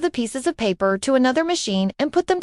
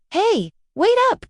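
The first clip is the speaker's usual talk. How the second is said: calling out; 7 semitones higher than usual. Acted. Calling after Judy running off.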